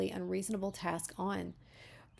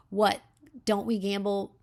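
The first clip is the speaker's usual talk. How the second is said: choked-up outburst; 6 dB louder than usual. A narrator's reading. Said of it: "with that sting in my eyes and a choking".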